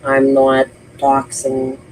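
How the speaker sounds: ordinary talk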